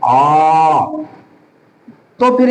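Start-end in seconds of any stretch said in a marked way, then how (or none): 0.1-0.8 आ stretched very long, as if calling out; prolonged vowel. Shown as a demonstration. Teacher demonstrates the extra-long vowel, prolonged आ. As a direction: hold आ for three beats like calling someone. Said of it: "यदि लंबा हो".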